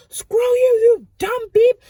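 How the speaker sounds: very loud, high angry retort; pissed-off shout. Retold or imitated. Dreamer cursing back at the witch, angry yell. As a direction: yell it back at full force. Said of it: "I was starting to get pissed".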